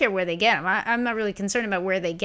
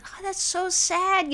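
high, slow mock lament; mock sad; 11 semitones higher, much slower than usual. Lamenting the fake death, high and slow mock sadness. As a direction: sigh it out in exaggerated sorrow.

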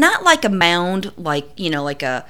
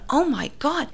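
high, softer exclamation of disbelief; retold dismay, pitched up; aghast under the breath; appalled reaction; 6 semitones higher, 5 dB quieter than usual. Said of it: "I'm now looking at this going".

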